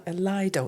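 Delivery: "Lido" said LIE-doh, with an eye vowel. Shown as a demonstration. Interviewer naming an outdoor pool, Lido said LIE-doh. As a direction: say the first syllable of "Lido" like "lie". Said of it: "Is it Lido or Lido?".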